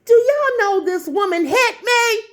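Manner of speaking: high-pitched, incredulous exclamation; outraged disbelief. Real, not acted. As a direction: exclaim it as a scandalous reveal.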